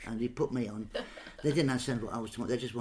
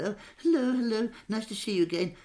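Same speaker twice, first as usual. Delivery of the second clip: lightly voiced high royal greeting; chirpy posh hello, pitched up; a hint of the Queen greeting him; imitating the Queen; 9 semitones higher than usual. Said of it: "she went".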